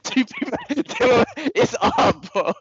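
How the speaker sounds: high, laughing and slowed; giggling with excitement over the statue; laughing gush. Real, not acted. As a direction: laugh the words out in giddy delight. Laughing while gushing about statue.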